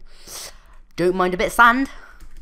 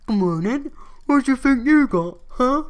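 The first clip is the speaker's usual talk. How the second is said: taunting tough-guy voice; 8 semitones higher, 4 dB louder than usual. Pretend. Taunting a mob in game.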